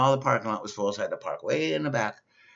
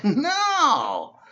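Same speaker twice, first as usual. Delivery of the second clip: exasperated no; 13 semitones higher, 6 dB louder than usual. Retold or imitated. Arguing with the Walmart clerk.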